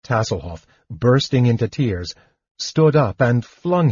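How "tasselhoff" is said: ordinary delivery, nothing marked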